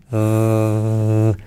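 "Th" sonora, muy alargada y grave; mantén zumbando la "Th"; sonido TH. Shown as a demonstration. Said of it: "Semiclave T H". ("Th" vibrante y sostenida)

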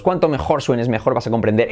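usual speaking voice

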